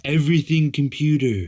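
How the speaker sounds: lightly voiced Trump imitation; a hint of Trump's cadence; Trump impression. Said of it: "I'm not gonna do a Trump impression".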